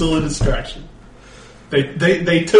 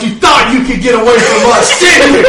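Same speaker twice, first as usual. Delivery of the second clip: loud, high gloating taunt; gloating hero; 12 semitones higher, 10 dB louder than usual. Acted. Luchador taunting the orc after flipping his cape, loud gloat. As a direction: crow it at the cornered enemy, loud. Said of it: "and it's like".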